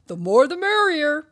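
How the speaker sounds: loud, high, carefree welcome; welcoming party host. Acted. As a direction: sing out a big welcome to all comers. Stanley welcomes crowds of dogs.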